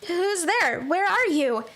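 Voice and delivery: usual speaking voice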